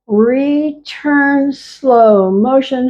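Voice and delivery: slowed right down, each word stretched; slow motion. Shown as a demonstration. Teacher slowing the class's moves down, slow and drawn out. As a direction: guide the class at a crawl. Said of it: "And again".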